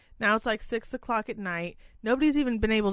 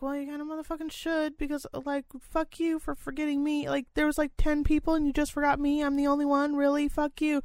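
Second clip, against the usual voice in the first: snarky retort; 7 semitones higher than usual. Retold or imitated. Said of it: "And I'm like".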